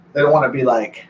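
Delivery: normal talking voice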